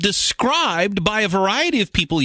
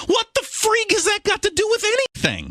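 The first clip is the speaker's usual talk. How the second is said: exasperated retort. Pretend. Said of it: "to which my response would be".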